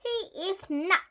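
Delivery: high, loud indignant retort; snapping. A narrator's reading. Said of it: "Chloe snapped".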